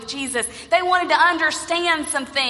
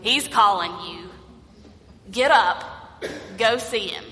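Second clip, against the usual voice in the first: reluctant; 6 semitones lower than usual. Acted. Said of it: "reluctantly went to Bartimaeus and said".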